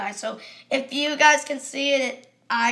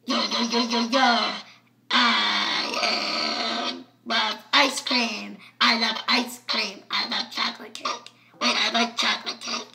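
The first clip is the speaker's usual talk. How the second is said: voice changer, second setting; 2 semitones lower than usual. Shown as a demonstration. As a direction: talk through a toy voice changer, drawing it out. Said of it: "this is what it sounds like".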